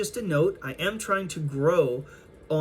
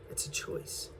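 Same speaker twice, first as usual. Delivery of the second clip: breathy whisper, barely voiced; whispered aside; 12 dB quieter than usual. Real, not acted. Reader whispers a reminder to the collective, soft whisper. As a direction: lean in and whisper it.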